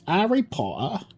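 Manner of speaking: high, posh British Harry Potter, rising; playful British accent on Harry Potter. Shown as a demonstration. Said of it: "if I want to be annoying, yes".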